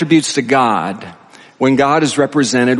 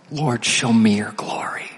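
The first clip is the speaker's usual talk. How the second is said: soft, nearly whispered plea to God; hushed prayer; 5 dB quieter than usual. Acted. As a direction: drop to a reverent near-whisper. Boldest prayer, said hushed first.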